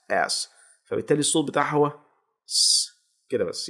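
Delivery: usual speaking voice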